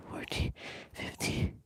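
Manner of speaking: whispering. Real, not acted. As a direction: breathe the last numbers out. Whispered end of counting set.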